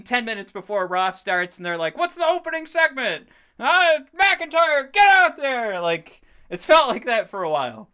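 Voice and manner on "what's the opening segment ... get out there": high, loud panicked shouting of orders; frantic backstage yell. Retold or imitated. Producers scrambling ten minutes before the show, frantic high yell. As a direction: bark orders like a flustered producer out of time.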